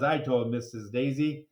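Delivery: ordinary delivery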